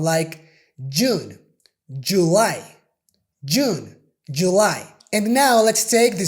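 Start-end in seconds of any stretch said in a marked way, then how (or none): 0.9-4.9 June and July with a strong j; strong English J. Shown as a demonstration. Teacher demonstrating the strong English J. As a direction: hit the j hard in June and July. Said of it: "the J sound is very strong".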